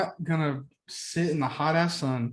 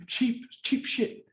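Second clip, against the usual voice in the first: scornful; 8 semitones higher, 3 dB quieter, much slower than usual. Real, not acted. Scorn for spaghetti.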